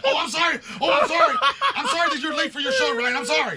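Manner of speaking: grovel in a squeaky panicked voice; whimpering apology, laughing at himself; mock pleading apology. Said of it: "I was like".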